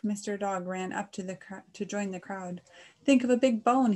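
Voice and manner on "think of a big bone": loud, high doggy exclamation; barked aloud. A narrator's reading. Mister Dog advises Mister Cow, barking aloud. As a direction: bark the words out loud. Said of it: "he barked aloud".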